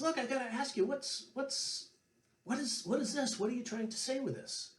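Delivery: high, baffled questioning voice; puzzled Johnny. Retold or imitated. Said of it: "And Johnny says".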